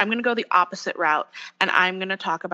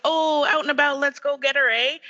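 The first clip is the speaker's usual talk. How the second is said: put-on higher-pitched stock Canadian accent; 7 semitones higher than usual. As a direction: put on a cartoonish Canadian lilt. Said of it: "the stereotypical".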